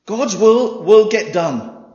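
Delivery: slow booming declaration; emphatic proclamation. Acted. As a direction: drive each word home.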